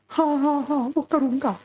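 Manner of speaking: put-on high eager little boy; high child voice promising mom. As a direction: answer like a small boy eager to please. Little boy Chhotu answers mother.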